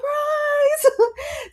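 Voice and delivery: real laugh. Real, not acted.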